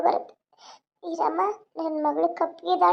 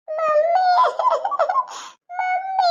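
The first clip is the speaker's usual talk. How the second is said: high wailing call for mummy; small child's tearful cry; 12 semitones higher, much slower than usual. Acted. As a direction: scream for your mother through tears. Child cries for leaving mother.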